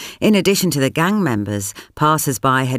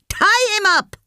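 shouting. Acted. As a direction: shout the order. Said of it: "Sampath shouted to Sushila".

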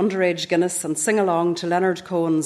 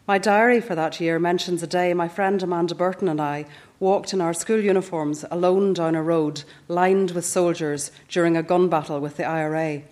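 usual speaking voice

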